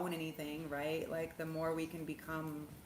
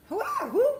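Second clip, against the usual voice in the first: much higher and louder, who who; squealing surprise; 10 dB louder than usual. Real, not acted. Reacting to something she sees, a high surprised squeal. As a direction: yelp it out in shock.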